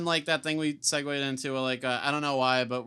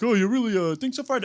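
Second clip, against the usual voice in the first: higher, doubtful cartoon sidekick; Barney Rubble voice; 7 semitones higher, 3 dB louder than usual. Barney doubts Fred's boast, higher cartoon voice. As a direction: ask it like a timid sidekick. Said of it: "he's like".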